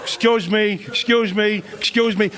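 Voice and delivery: De Niro-like repeated tough guy; menacing father-in-law. Retold or imitated. Comic imitating his wife's scary father, De Niro tough guy. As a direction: lean in, say it like a threat. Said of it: "He said everything three times".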